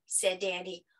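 ordinary delivery, nothing marked

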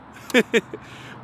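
a quick airy laugh at his own joke; breathy laugh. Real, not acted. Angler laughing at his Alaska mix-up, airy laugh. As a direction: laugh it off, unvoiced and bright.

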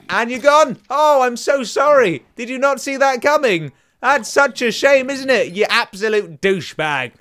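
loud, high, drawn-out taunt; hammed-up sarcastic gloating; gloating mock sympathy. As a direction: fake apology to a beaten foe. Taunting the enemy he just killed.

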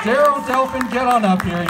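loud call to the crowd. Real, not acted. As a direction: summon them up loudly. Calling audience member up.

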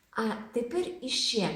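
ordinary talk

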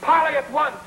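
high, insistent summons; impatient yell. Acted. Husband yells for his wife to come at once. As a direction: call out high, demanding she come. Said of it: "Stop yelling".

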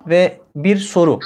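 ordinary delivery

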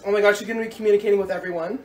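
ordinary delivery

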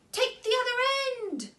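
high call across. Acted. Kitty calling to Clara to grab the ribbon. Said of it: "she called to Clara".

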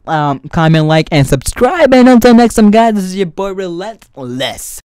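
loud, slow hype sign-off; youtube outro. Acted. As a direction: shout the outro to the fans.

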